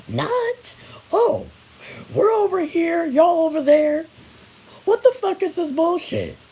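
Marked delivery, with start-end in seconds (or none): none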